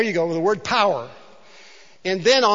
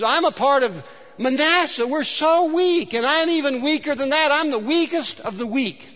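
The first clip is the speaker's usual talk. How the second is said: whining Gideon; 7 semitones higher than usual. Acted. Preacher voicing Gideon's hand-wringing whine to God. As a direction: whimper like a hand-wringing coward. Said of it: "He wrings his hands and crosses his legs and whines".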